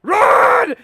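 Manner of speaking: high, very loud yell; yelling run. Retold or imitated. Joel yelling to Sam, Henry and Ellie to flee. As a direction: scream the warning at full force. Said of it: "Joel's like".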